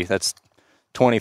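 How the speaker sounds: usual speaking voice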